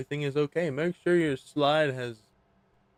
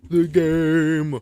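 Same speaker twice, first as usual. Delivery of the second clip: loud dramatic repeat; loud echo; 4 semitones higher, 9 dB louder than usual. Pretend. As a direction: call it out grandly. Echoing his own command.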